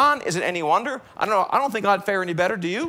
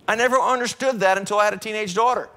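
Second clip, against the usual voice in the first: ordinary talk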